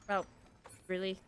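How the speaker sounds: usual speaking voice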